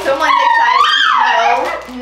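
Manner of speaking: very high, drawn-out squeal; squeaky high exclamation. Real, not acted. Dog jumping up.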